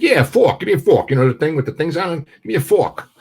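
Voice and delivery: a brash New Yorker spelling it out; pushy Brooklyn accent. Retold or imitated. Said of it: "Guy from Brooklyn".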